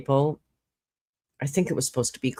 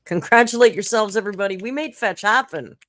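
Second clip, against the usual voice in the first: mock triumphant; 6 semitones higher, 4 dB louder than usual. Celebrating the Discord meme.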